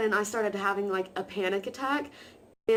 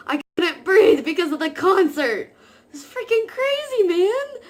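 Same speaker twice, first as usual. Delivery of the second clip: loud, high outburst, climbing higher; incredulous exclaiming; 10 semitones higher, 9 dB louder than usual. Real, not acted. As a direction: exclaim it in loud, rising disbelief. Exclaiming over panic attack at concert.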